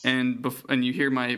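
normal talking voice

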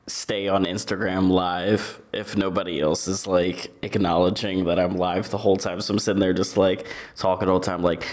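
ordinary delivery

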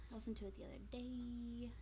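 whispered trailing off. Real, not acted. Friend giving up on the name, whispering. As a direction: let it fade to a whisper.

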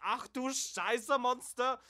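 put-on high, loud, slow German-accented yelp; loud German-accented cry of alarm; German outburst. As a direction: shriek it like a startled German ambassador. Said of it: "he goes".

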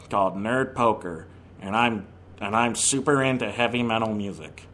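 deep nasal metalhead introducing himself; Brian Posehn impression. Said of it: "I think I nailed it".

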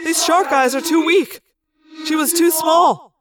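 mild frustration with echo; protest, a touch exasperated; frustrated yell. A narrator's reading. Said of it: "Axe yelled in frustration".

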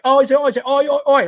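mock Aussie bloke, high and loud; hammed-up Aussie. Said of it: "coming up with some Aussie guy".

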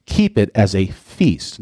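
slow, weight on "keep" and "feast"; stress on feast. Shown as a demonstration. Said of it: "another important word".

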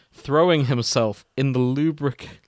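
ordinary delivery

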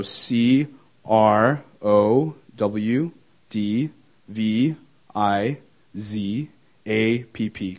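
slow, separated letters with rising tune; slow spelling out. Real, not acted. Speaker spelling Twitter handle for audience, slow dictation. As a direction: spell it letter by letter for note-takers.

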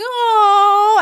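high, loud melting "oh"; hammed-up lovestruck wail; swooning "oh". Drunk woman hears his love confession, swooning wail.

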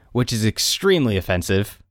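usual speaking voice

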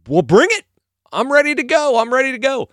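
eager, high and loud challenge; David. Retold or imitated. David insisting he will fight, cocky and eager. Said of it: "And he's like".

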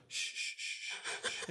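soft, breath-only hushing; repeated soothing shh; calming shush. Acted. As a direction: shush gently to calm someone panicking. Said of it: "calm him down".

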